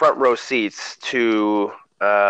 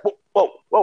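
startled whoa; 11 semitones higher, 3 dB louder, faster than usual. Retold or imitated. Baby suddenly slips out.

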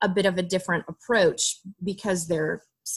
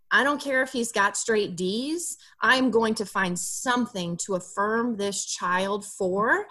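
usual speaking voice

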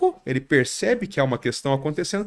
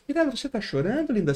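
a touch of tender concern, lightly higher; mock tender; 8 semitones higher than usual. Shown as a demonstration. Showing the right empathic way, mildly tender. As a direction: sweetly ask if she's crying. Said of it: "eu tenho que falar assim".

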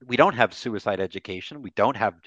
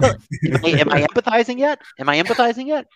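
mock anxious asking; 12 semitones higher, 5 dB louder than usual. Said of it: "mocking self-doubt".

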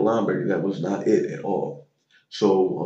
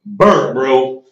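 ordinary talk

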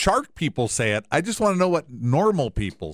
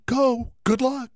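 mock cheerful send-off; 8 semitones higher, slower than usual.

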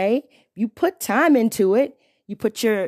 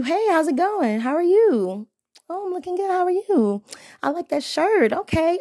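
higher, bright friendly hello; friendly greeting demo; 9 semitones higher than usual. Shown as a demonstration. Demonstrating a polite opener to men, friendly and high. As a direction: show how a nice opener sounds. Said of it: "you start off with".

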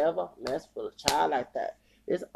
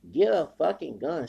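ordinary talk

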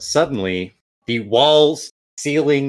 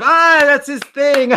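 crowd laughing; 14 semitones higher, 4 dB louder than usual. Acted. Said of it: "crowd laughing as if".

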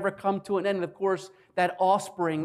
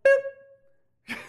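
sudden very high-pitched boop; comic boop; 5 dB louder than usual. Acted. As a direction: squeak out a little cut-off sound effect. God cuts the king off.

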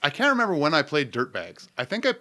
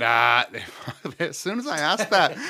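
usual speaking voice

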